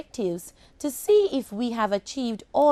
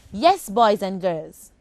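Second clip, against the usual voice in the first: ordinary talk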